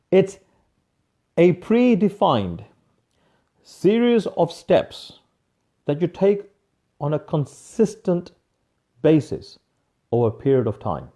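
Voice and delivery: slow, each phrase spaced out and stressed; slow emphatic reveal. Shown as a demonstration. Coach reveals the secret to students, slow and emphatic. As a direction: lay it out word by word, very slowly. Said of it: "announced secret, slow emphatic reveal".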